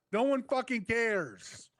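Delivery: usual speaking voice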